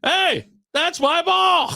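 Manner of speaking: high, slow shouted call; far-off yell. Retold or imitated. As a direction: yell it across the fairway. Golfer yelling across the course.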